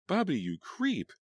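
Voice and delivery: high, slow sneer. Acted. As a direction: sneer the insult at him. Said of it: "she sneered at him".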